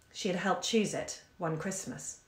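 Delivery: ordinary delivery, nothing marked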